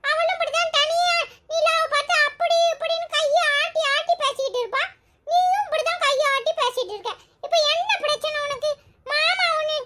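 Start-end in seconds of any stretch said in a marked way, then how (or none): none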